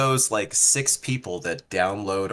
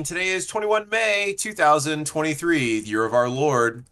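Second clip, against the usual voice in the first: high, booming show-host intro; announcer voice; 7 semitones higher than usual. Acted. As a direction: hype it up like a radio host.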